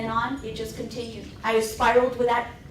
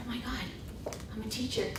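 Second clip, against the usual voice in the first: soft stunned aside; awed inner thought; 11 dB quieter than usual. Retold or imitated. New teacher on first day, softly stunned. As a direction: breathe it out in disbelief.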